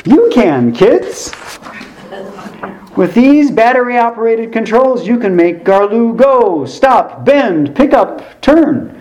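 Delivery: high, upbeat toy-commercial pitch; peppy ad announcer listing the toy's tricks; happy announcer voice. Acted. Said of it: "he shifts to a happy voice".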